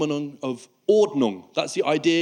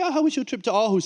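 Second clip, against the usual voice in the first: bright friendly question; 7 semitones higher, faster than usual. Retold or imitated. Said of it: "I was like".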